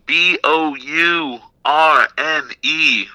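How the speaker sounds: each letter of B O U R N E spelled out slowly; spelling slowly. Real, not acted. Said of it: "go ahead".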